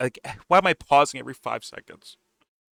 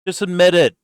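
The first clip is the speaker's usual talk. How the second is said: emphatic demand; 8 semitones higher, 7 dB louder than usual. Real, not acted. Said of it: "ranting at viewers".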